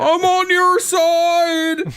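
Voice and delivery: hammed-up high, loud, drawn-out impression; David Harbour's Hellboy. Podcaster mocking an actor's Seinfeld-like Hellboy, loud slow impression. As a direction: stretch every word slowly in a mocking, high-pitched holler. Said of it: "trying to do a Seinfeld impression the whole time".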